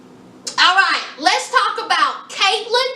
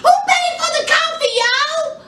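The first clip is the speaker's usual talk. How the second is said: squealed punchline; 11 semitones higher than usual. Real, not acted. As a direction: repeat it squealing to the crowd. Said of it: "repeats the punchline".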